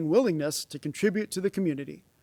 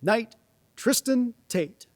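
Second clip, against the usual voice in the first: slow, formal name call, pitched up; knighting ceremony; 5 semitones higher, slower than usual. Real, not acted.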